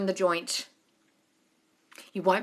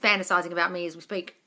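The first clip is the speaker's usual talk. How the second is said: quiet, calm self-affirmations; soft affirmation voice; 6 semitones lower, 7 dB quieter, faster than usual. Shown as a demonstration. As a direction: murmur them like a mantra. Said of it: "Just affirm, affirm, affirm".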